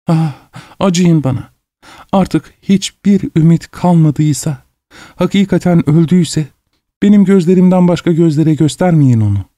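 high-pitched begging for pity; desperate plea. A narrator's reading. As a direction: implore him in a high, pained woman's voice. Woman begs the doctor for pity. (tiz sesle acınarak yalvarma)

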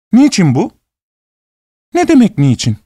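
high, hurt landlady voice; hurt young woman. A narrator's reading. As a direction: protest high and wounded. Sofya indignant reply.

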